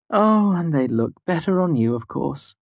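high, drawn exasperated grumble, falling away. A narrator's reading. Devi sees her earrings on Fela, exasperated. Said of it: "gave an exasperated sigh".

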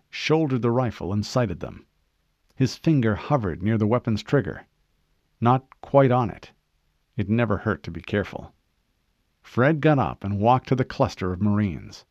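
usual speaking voice

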